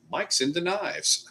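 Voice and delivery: dawning realization. Retold or imitated. Streamer retelling his own realization about Mike, high slow dawning. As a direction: let the penny drop on "knives". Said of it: "And I'm like".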